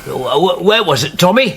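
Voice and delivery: grasping for a lost memory, getting agitated; stammering confused old man, growing distress; upset, confused. Acted. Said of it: "don't upset yourself".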